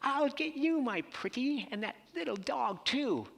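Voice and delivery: high cackling witch threat; a high, menacing storybook witch; wicked witch voice. Retold or imitated. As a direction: sneer it like the Wicked Witch of the West. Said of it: "like the wicked witch from The Wizard of Oz".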